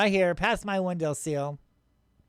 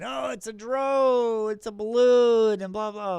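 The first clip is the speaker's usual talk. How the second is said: mocking the doubters; 3 semitones higher, 3 dB louder than usual. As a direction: imitate clueless doubters in a stretched, raised voice. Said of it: "a lot of people are saying".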